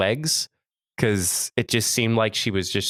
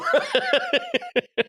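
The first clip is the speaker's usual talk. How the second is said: high laugh. Real, not acted. Laughing at own story.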